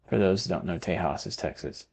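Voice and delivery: normal talking voice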